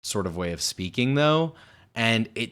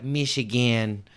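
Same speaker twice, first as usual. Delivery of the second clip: mocking, drawn-out Michigan accent; exaggerated Michigan twang, mock candidate; mimicked rural Michigan accent; 4 semitones higher than usual. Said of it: "she's like".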